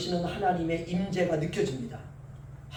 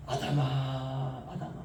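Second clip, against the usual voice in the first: soft, slow, tender call; gentle calling; 8 semitones lower, 9 dB quieter, slower than usual. Acted. God gently calling sinful Adam, soft tender call. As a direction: call the name quietly like a loving father.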